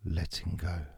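soft, fading meditation countdown; guided meditation. Acted. Leads a countdown relaxation.

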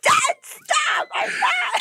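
high, shrieking protest at dad; protesting shout. Retold or imitated. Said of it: "And I'm like".

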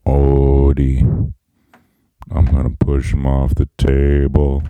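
very low, loud cartoon cat voice; Garfield. Host trying out his own Garfield voice, deep and loud. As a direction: drop the voice deep and push it out, on a monotone. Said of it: "how about mine? Let me, let me try it out".

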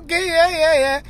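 high-pitched mock going-along; humouring agreement. Acting out his bemused reply.

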